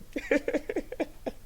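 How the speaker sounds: a short breathy burst of laughter; helpless little laugh at the news. Real, not acted. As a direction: laugh in spite of yourself. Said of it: "I don't know why I'm laughing".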